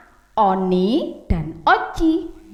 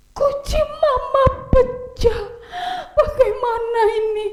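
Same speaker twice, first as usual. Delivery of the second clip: put-on panicked boy; 11 semitones higher than usual. Oni sees the broken vase, high mock panic. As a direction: act out a frightened little boy.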